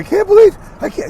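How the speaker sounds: very high, loud falsetto mimicry; mock a shocked friend in a squeal; hammed-up panicky impression of a friend; absurd put-on voice. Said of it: "he didn't talk like that".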